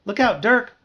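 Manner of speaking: high, loud cry of alarm; alarmed warning. Acted. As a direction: warn them urgently. Narrator's alarmed thought, friend in danger.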